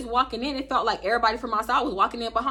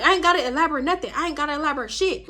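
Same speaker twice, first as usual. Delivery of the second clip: mock brush-off; 5 semitones higher, faster than usual. Imitating Nisha's dismissal.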